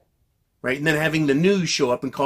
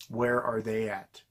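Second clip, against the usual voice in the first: softer and slower, letting it sink; quiet slow repeat; 4 semitones lower, 7 dB quieter than usual. Acted. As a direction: drop the voice on the repeat. Rhetorical question repeated, dropped down.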